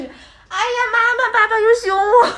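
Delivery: put-on high, loud crying child's whine; cutesy whiny pleading. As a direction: whine like a sobbing little girl. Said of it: "学会撒娇".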